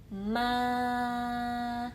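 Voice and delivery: MA held long like a choir note; "Ma" on one long straight note; level first tone. Shown as a demonstration. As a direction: sustain MA high and perfectly level. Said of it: "Seperti paduan suara".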